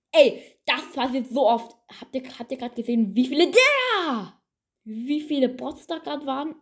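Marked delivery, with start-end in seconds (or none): 3.1-6.6 incredulous. Real, not acted. Disbelief at bots.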